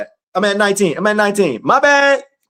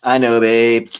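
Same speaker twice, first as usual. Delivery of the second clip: low, slow soothing partner; put-on reply voice; 9 semitones lower, slower than usual. Preacher voices a partner's reply to the complainer, low soothing voice. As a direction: drop deep and reassure them slowly. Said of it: "I gave them these voices".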